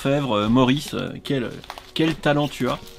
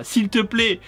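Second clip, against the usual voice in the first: voix haute, implorante pour rire; lis-le comme une supplique exagérée; supplique théâtrale; 6 semitones higher than usual. Acted. Lit la supplique comique de Maurice. (supplication jouée, haut perchée)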